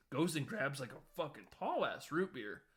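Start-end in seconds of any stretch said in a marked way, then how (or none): none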